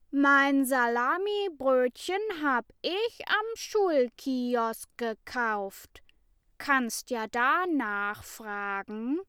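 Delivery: high, loud crowing voice. A narrator's reading. Mats cuts in over the class, high crowing. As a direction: crow it over the others. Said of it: "Und Mats krähte dazwischen".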